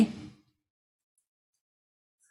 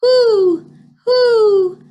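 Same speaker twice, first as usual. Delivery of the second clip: high, loud, sustained hoots; long high storybook owl calls; 9 semitones higher, 6 dB louder, much slower than usual. A narrator's reading. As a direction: hoot like an owl. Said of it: "says the owl".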